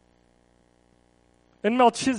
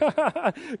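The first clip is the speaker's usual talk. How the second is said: a short awkward chuckle at his own news; awkward laugh; 5 semitones higher than usual. Retold or imitated. Abram laughing awkwardly to his friend Melchizedek. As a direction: laugh it off, a little embarrassed.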